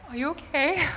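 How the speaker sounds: mother teasingly checks on son, laughing; laughing question. Retold or imitated. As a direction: ask it half laughing. Teasing son next morning.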